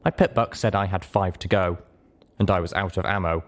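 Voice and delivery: plain reading voice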